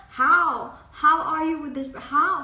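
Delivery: hammed-up jealous colleague shouting how; loud disbelieving outburst, played for effect; mock jealous demand.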